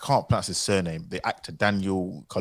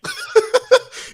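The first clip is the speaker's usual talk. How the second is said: high disbelieving laugh; 9 dB louder than usual. Real, not acted.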